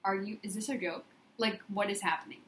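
low and quiet, can't believe it; hushed, disbelieving murmur; mock-stunned under her breath; stunned disbelief. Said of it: "And I was like".

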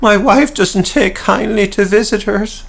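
nervous stonemason begging the slayers to stay out; frantic plea. Acted. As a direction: plead with them desperately. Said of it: "his pleas were to no avail".

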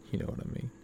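breathy whispered echo. Shown as a demonstration. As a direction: whisper it to yourself. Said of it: "repeats line, second whispered".

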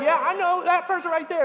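high, loud knowing recognition; eager agreement. Retold or imitated. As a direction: point someone out eagerly. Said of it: "Y'all are like".

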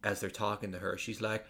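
ordinary talk

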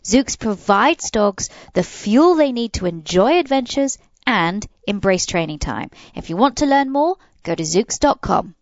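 upbeat sponsor announcement; ad read. Acted. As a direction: sell it like a radio spot.

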